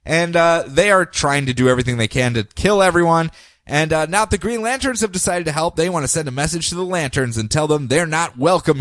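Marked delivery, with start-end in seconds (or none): none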